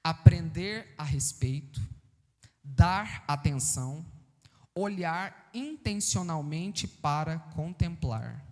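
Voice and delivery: slow, measured dictation with clear pauses; slow right down so listeners can write it; falando mais devagar. Real, not acted. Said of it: "vou falar mais devagar". (devagar, em ritmo de ditado)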